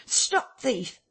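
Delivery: high-pitched call to stop; alarmed cry. A narrator's reading. Shopkeeper chasing a thief across the road, high alarmed cry. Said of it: "cried Huxter".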